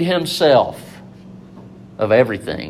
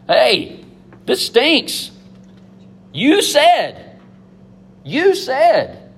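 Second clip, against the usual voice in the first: higher, whiny complaint to God; a mock-whiny protest, pitched up; complaining; 9 semitones higher, much slower than usual. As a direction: gripe like a let-down child. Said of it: "A lot of the Psalms are just complaining".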